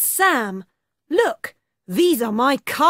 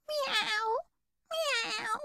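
high, soft meowing; cat meow; 10 semitones higher, 12 dB quieter than usual. Acted. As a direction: mew softly like a kitten. Cat sound.

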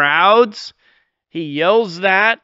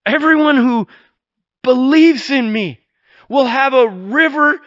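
higher and louder, ringing out; preacher shouting the promise to the crowds; shouted proclamation; 7 semitones higher, 4 dB louder than usual. Acted. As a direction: call it out over the crowd. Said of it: "He shouts it".

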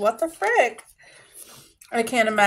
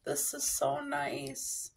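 very soft, slow, teary; tearful whisper; 5 semitones higher, 13 dB quieter, much slower than usual. Real, not acted. Thanking her friend for the letter, soft tearful voice. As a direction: barely get it out through tears. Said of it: "I need a Kleenex".

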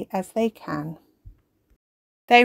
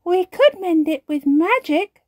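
put-on fairy girl voice; 11 semitones higher, 6 dB louder than usual. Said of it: "suggests Strawberry".

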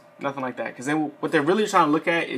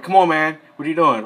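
louder, exasperated scolding of a recruit; annoyed examiner calling out a recruit who got it wrong; irritated instructor; 2 semitones higher, 7 dB louder than usual. Retold or imitated. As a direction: snap at the one who keeps messing up.

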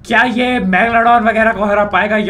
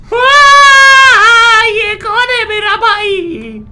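wailing singsong; 13 semitones higher, 6 dB louder, much slower than usual. Real, not acted. Sees a huge creature.

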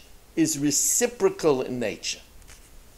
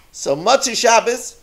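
ordinary talk, nothing marked

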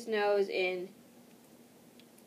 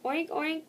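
piglet oink; 7 semitones higher than usual. A narrator's reading. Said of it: "the piglet squealed".

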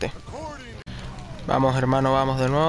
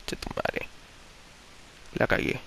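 whispered curse; 11 dB quieter, slower than usual. Real, not acted. Muttered curse after a mistake.

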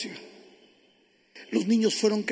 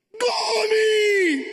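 long, high shout of her name; overwhelmed cry; 10 semitones higher, 4 dB louder than usual. Acted. Husband seeing his lost wife at the door, crying out. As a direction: cry the name out, stretched and soaring.

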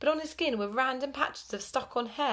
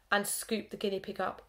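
ordinary delivery

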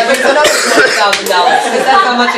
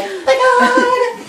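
very high, drawn-out singsong; mock giddy excitement; slower than usual. Herbert awaiting Pip's arrival, mock giddy squeal. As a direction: squeal it slowly in hammed-up glee. Herbert thrilled Pip is coming.

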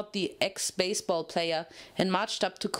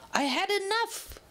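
fed up; 11 semitones higher than usual. A narrator's reading. Quake loses her temper.

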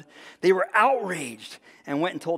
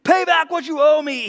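loud, raised angry demand; forceful demand; 10 semitones higher, 8 dB louder than usual. A narrator's reading. Servant choking his fellow servant over a debt, loud demand. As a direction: hurl it at the debtor, high and loud. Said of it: "he demanded".

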